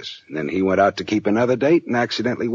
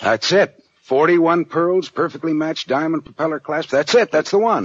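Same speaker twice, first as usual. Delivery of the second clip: higher and louder, eager over the pearls; light up as you spot the necklace; thrilled at finding the pearls, higher pitched and loud; excited recognition; 9 semitones higher, 4 dB louder than usual. Acted. Detective recognizes the stolen necklace.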